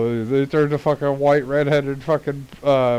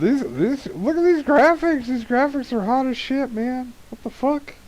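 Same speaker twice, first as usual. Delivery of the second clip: excited; 14 semitones higher than usual. Real, not acted. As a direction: gush over the graphics. Awed by game graphics.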